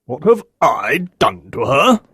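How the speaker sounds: angry retort. Acted. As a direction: throw the question back with a touch of anger. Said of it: "he repeated angrily".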